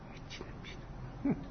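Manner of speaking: slow whisper, breathed not voiced; whispered aside. Telling students how his teacher dismissed him, slow whisper. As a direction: drop to a slow secret whisper.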